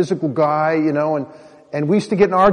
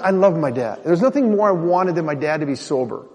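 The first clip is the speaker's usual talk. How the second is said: normal talking voice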